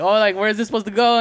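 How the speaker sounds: high, quick, lost new guy asking; mock confused question. New worker asking where stock goes, high and quick.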